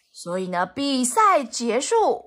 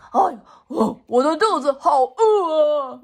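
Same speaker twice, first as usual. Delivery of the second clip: moaning pig voice, a little louder; hungry pig; 5 semitones higher, 6 dB louder than usual. Acted. Lightly voiced pig announcing its hunger to everyone, moaning. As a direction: groan like a starving little pig.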